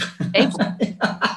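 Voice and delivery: high-pitched giggle; a light high laugh. Real, not acted. Laughs at guest's joke.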